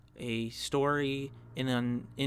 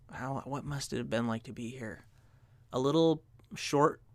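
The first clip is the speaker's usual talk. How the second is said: normal talking voice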